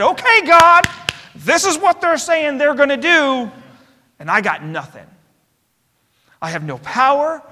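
loud pleading cry to God; king spreading the letter before God, calling out loud; desperate plea. Retold or imitated. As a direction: cry out to God, nothing left. Hezekiah crying out before God.

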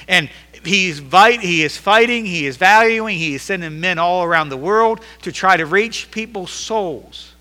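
loud, high, driving pulpit delivery; fiery sermon climax; full-force preaching. Acted. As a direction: preach it hard to the congregation.

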